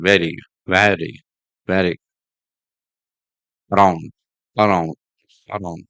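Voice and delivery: VERY and AROUND with a light middle R; smooth light R. Shown as a demonstration. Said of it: "often smooth and light".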